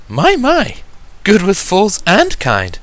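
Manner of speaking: high, refined, lilting lady's voice; lightly accented lady. Acted. Boutique owner admires a polite visitor, high and refined. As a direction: purr it like an elegant fashionista. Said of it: "a soft, lightly accented voice spoke".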